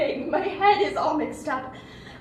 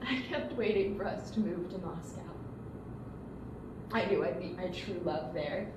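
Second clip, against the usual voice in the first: much lower and much softer, withdrawn; quiet resignation; 10 semitones lower, 14 dB quieter than usual. Acted. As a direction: barely voice it, as if admitting it to herself. Confessing lost dreams to sister.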